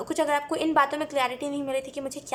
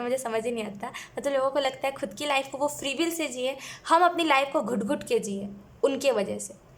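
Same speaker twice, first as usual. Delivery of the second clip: ordinary talk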